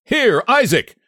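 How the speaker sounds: high-pitched, cross summons; angry call. A narrator's reading. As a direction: call a farmhand over angrily. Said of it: "made him more angry still".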